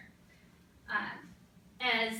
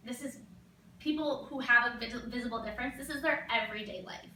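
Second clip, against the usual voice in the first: ordinary talk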